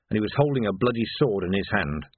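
ordinary delivery, nothing marked

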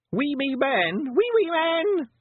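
put-on very high, drawn-out child's squeal; delighted piercing toddler cry; gleeful toddler shout. A narrator's reading. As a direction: chant it like an overjoyed little boy. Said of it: "shouted Wentworth gleefully".

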